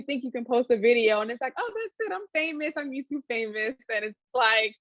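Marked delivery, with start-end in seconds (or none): 1.6-3.7 high, soft mock-starstruck voice; naive excitement. New YouTuber imagines instant fame after one video, naive excitement. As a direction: play the instant-fame fantasy, starting high and easing down.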